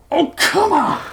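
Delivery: high, strained yell; strained, indignant outburst; exasperated shout. A narrator's reading. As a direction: shout it in high-pitched protest. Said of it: "the doctor shouted".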